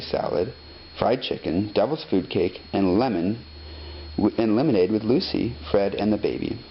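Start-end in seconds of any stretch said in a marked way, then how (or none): none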